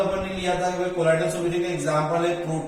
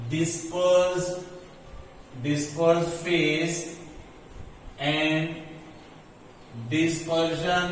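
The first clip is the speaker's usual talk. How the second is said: drawn-out phrases with long gaps; slow dictation; 4 dB quieter, much slower than usual. Real, not acted. Teacher writing out the rule for students, slow dictation. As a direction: say it piece by piece as you write. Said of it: "तो लिखता हूं मैं यहाँ पर".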